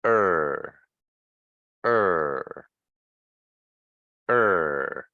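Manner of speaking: "er" repeated low and drawn out, falling each time; isolated er sound. Shown as a demonstration. Teacher modelling the sound for students, low, long falling "er". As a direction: say each "er" alone, tongue tip touching nothing. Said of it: "So it's".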